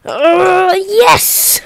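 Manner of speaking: high, loud joyful yes; excited cheer. Real, not acted. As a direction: cheer out a big win. Game milestone reached, cheering.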